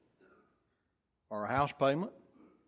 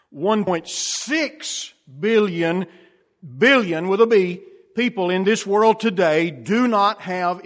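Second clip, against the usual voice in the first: loud, raised sermon voice; emphatic preacher, leaning hard on key words; forceful preaching; 5 semitones higher, 12 dB louder than usual. Real, not acted. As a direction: punch out each statistic to the congregation.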